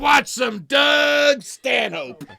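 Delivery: loud, slow, shouted mock Crow voice. Host shouting as Crow for the chat, slow loud imitation. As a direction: bellow it slowly like Crow hyping himself up. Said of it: "That was a really good impression of Crow".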